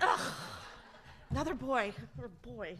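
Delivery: loud, groaning mock disappointment; a big playful ugh of letdown; mock disgust. Aunt mock-complaining at the newborn nephew, loud and groaning. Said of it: "I was so mad he was a boy".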